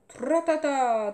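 slow, loud trumpet-like chant of tratata; mock trumpet call. A narrator's reading. Sick boy imitates a battle trumpet, slow and loud. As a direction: blare it like a bugle.

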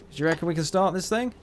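ordinary talk

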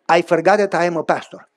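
ordinary delivery

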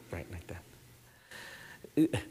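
barely voiced, slow whisper; hushed whisper to the congregation; whispered aside. Real, not acted. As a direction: breathe it out like a secret.

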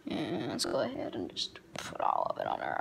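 hushed unvoiced aside; whispering. Real, not acted. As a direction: whisper it to yourself. Whispering while cooking.

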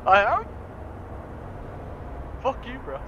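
ordinary delivery

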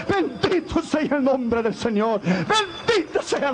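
rising, slow, impassioned proclamation; preaching at full force. Acted. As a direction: thunder the promise out to the church. (predicando con fuerza)

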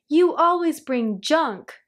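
a contained scream of complaint; mild storybook scream. A narrator's reading. Aunt Nasty berating the mailman, mild scream. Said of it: "screamed Aunt Nasty".

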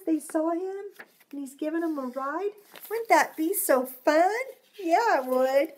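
plain reading voice

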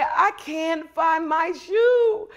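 put-on high, drawn-out little-kid whine; hammed-up, slow, high-pitched wail; mock crying child. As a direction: play at being a tearful child, dragging it out. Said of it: "And I would be crying".

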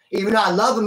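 ordinary delivery

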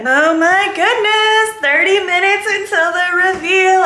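high-pitched, drawn-out thrilled announcement; excited squeal. Real, not acted. Vlogger counting down to her reveal, excited squeal. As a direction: stretch it out high with giddy excitement.

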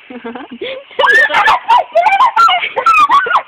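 shrieking laugh. Real, not acted.

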